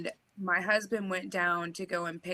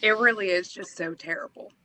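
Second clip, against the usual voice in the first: ordinary delivery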